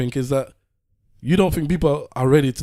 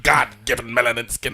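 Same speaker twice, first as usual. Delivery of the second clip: lightly mocking, slowed echo of his words; mock quote; 3 semitones lower than usual. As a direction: parrot him back a bit slowly, teasing.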